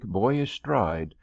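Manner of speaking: ordinary delivery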